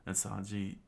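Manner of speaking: ordinary delivery, nothing marked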